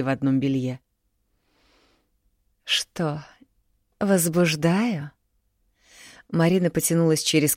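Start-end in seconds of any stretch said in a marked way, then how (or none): 2.6-5.1 slow, whispered come-on; seductive tease. A narrator's reading. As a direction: draw it out to tempt him. (медленно, соблазнительно, шёпотом)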